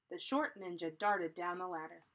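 ordinary delivery, nothing marked

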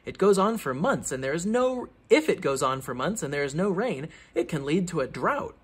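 plain reading voice

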